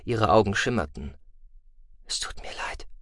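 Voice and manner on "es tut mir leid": tearful whisper. Acted. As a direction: breathe the apology. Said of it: "flüsterte sie". (flüsternd)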